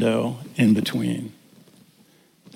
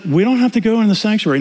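imitating his wife; 7 semitones higher, faster than usual. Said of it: "So she's like".

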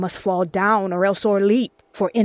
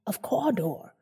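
whispered; 2 semitones higher, 6 dB quieter than usual. Acted. Letter read, whispered title.

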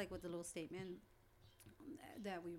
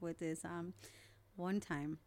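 ordinary delivery

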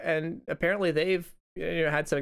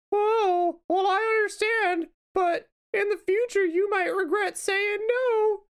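put-on very high, stretched-out girl voice, pleading; hammed-up high American girl, coaxing; 4 dB louder, slower than usual. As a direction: draw it out in a squeaky-high girl voice. Acted train skit, American girl.

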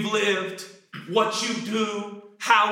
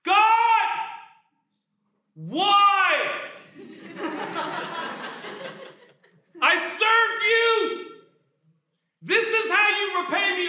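anguished outcry; 13 semitones higher, slower than usual. Acted. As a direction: plead and shout up at God, stretched out. Paul crying out to God.

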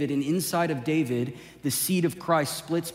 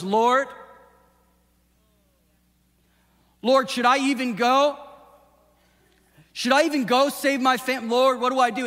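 pleading David; 8 semitones higher, 4 dB louder than usual. Acted. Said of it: "And he goes".

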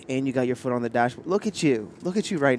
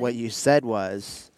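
usual speaking voice

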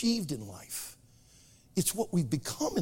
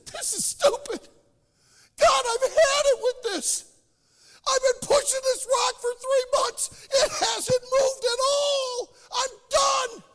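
exasperated outburst; 6 dB louder than usual. Acted. Guy gives up after three months.

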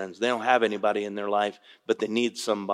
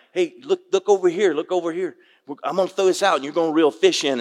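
put-on higher, louder, eager call to the kids; excited calling out; 6 semitones higher, 7 dB louder than usual. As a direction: call the boys over, all excited. Calling grandsons to watch fish.

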